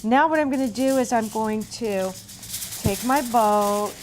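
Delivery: ordinary talk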